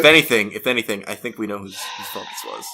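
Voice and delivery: low, quiet accusing mutter; hushed sinister accusation; ominous hush. Acted. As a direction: drop it low and menacing.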